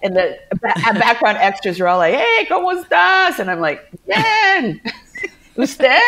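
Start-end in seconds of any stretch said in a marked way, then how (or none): 2.1-3.4 high, loud acted hello; boisterous greeting. Acted extras calling out in Spanish. 4.1-6.0 very high, loud mock answer; awkward squeaky reply. Acted awkward Spanish reply.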